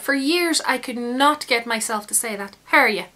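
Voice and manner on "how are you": "How are you?" run together as "ha-ri-ya"; Irish "how are you". Shown as a demonstration. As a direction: clip "How are you?" down to "hah-ree-ya". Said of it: "You don't even pronounce the W and the Y".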